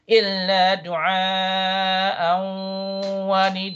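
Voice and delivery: long madd vowels and a humming merged N; Quran recitation. Shown as a demonstration. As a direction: recite as a tajweed model. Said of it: "it's idgham bi ghunna".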